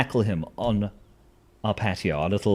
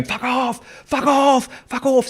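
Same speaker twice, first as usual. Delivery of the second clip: high, loud repeated fuck off; wife yelling; 6 dB louder, slower than usual. Retold or imitated. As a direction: bellow it like echolocation. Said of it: "she's just going".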